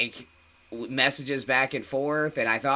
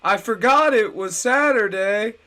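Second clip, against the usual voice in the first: loud, high put-on voice; high, loud impersonation; Danger Bear Wolf Turtle; 9 semitones higher, 7 dB louder than usual. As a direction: belt it out like a caricature. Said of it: "Danger Bear Wolf Turtle, he".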